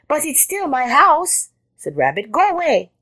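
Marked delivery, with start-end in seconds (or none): 0.1-1.5 high rabbit protest. A narrator's reading. Rabbit objecting to Jackal's plan, high protest. 2.3-2.9 high-pitched indignant rabbit; indignant cry. A narrator's reading. Rabbit defending the house from Jackal, high indignation.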